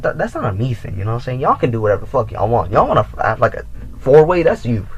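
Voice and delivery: ordinary delivery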